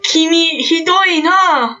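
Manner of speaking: high, slow, appalled student; hammed-up indignant Japanese reproach; Ida. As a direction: draw it out high, scandalised. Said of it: "Ida's just like".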